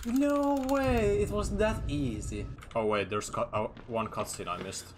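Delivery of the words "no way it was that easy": high, stretched shout of disbelief; excited shout. Real, not acted. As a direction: yell it out in total amazement. Gamer pulls off a skip.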